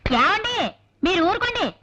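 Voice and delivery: high-pitched wifely rebuke; exasperated hushing of a husband; scolding. Acted. Wife hushes her rude husband.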